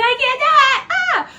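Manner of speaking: high, loud flustered shriek; embarrassed squeal. Retold or imitated. Giving up on sounding sexy, loud squeal. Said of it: "I was like".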